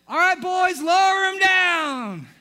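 high, loud, slow call to the crew; drawn-out rooftop command; shouted order. Acted. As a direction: stretch it out like a foreman hollering. Friend calls orders from the roof.

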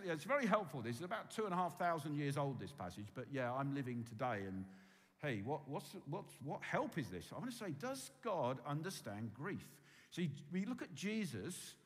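usual speaking voice

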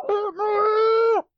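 high, loud, drawn-out mock shout; muffled-prank shout. Reading out the dead granddad's prank, shouting. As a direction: yell it long and high, playfully. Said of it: "when they heard him shouting".

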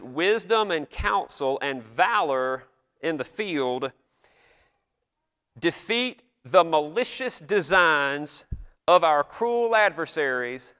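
slow, lifted solemn prayer reading; solemn old prayer. Retold or imitated. Pastor reading an old Congress prayer to the congregation, slow and solemn. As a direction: intone it like an eighteenth-century pastor at prayer. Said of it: "I wish I could go around talking like this today".

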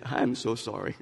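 soft, near tears, barely holding it together; choked-up apology. Real, not acted. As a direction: apologize quietly, voice close to breaking. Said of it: "I might start crying".

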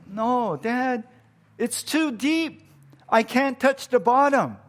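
scared little daughter. Retold or imitated. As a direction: play the frightened little girl who can't touch bottom. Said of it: "And she's like".

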